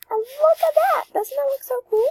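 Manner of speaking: high-pitched, excited exclamation; excited delight. Real, not acted. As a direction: burst out high and thrilled. Delighted by the hair result.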